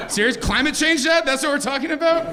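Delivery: high-pitched, indignant disbelief; incredulous. Acted. Son questions his dad's gloomy retirement answer, incredulous. Acted incredulous reply to dad.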